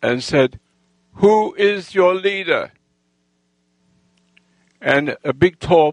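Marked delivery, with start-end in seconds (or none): 1.1-2.7 higher, slow drawn-out challenge; re-enacted slow, high call. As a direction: call across to a gang, stretching each word. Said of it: "I called out to him".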